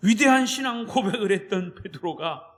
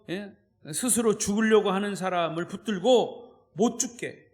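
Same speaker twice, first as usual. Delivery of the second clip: ordinary talk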